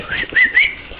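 high whistle imitating the bird's call; whistled call. Calling to her pet bird Rosie with a high imitated whistle. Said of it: "like we used to whistle to each other".

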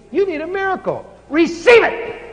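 high, loud commanding cry; commanding yell. Retold or imitated. Preacher yelling a command at the woman's arm, loud and high. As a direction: hurl the command at her. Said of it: "the first time I'd ever yelled like that".

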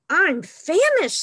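loud, high cry of a hungry cub; dramatic hunger. Acted. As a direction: exaggerate it at high pitch. Third cub tops the others.

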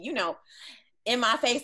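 ordinary talk, nothing marked

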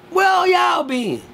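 high, slow shout; slow, high-pitched callout at the crowd; mock shout. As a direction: drag it out like yelling at no-show relatives. Calling out absent relatives.

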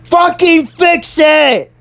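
high, slow yelled command; retold yell. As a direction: imitate the principal yelling an order. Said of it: "she yells at him".

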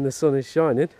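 normal talking voice

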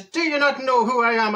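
insistent mock Irish accent; put-on Irish accent. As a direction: lean harder into a stage Irish accent. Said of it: "That's an Irish accent".

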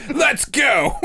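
high, hammed-up bad Australian yell; mock Australian accent. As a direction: call out in a botched Australian accent. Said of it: "Here's [name]'s Australian impersonation".

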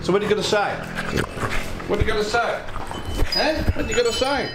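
high teasing pet voice; playful telling-off for a naughty dog; talking to the dog. Real, not acted. Teasing the dog about chewing.

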